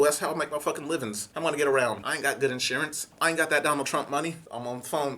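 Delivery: normal talking voice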